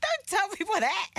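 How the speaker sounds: high-pitched mock protest; retold protest. Retold embarrassed protest to friend.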